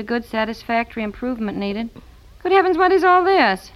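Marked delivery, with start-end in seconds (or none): 2.4-3.7 high-pitched, puzzled astonishment; baffled exclamation. Acted. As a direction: exclaim in bewilderment. Mother baffled by report card.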